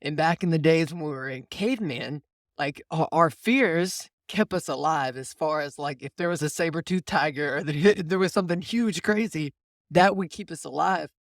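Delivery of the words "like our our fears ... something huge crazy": ordinary talk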